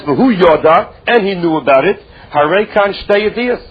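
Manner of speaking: ordinary talk, nothing marked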